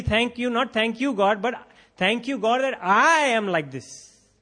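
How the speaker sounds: mock Pharisee thanking God he's better; self-righteous prayer. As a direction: gloat to God, drawing out the end. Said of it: "That was self-righteousness".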